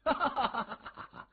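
high, mocking cackle; gleeful villain laughter; villain's ha-ha laugh. A narrator's reading. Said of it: "那个青年哈哈的笑起来".

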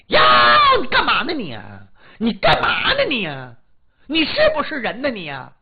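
loud, shrill tirade of a wife; furious scolding. Acted. Wife berating her drunk husband on birthday, furious shout. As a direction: yell at him like she's lost it. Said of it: "这大奶奶黄腊梅顿时就翻脸了".

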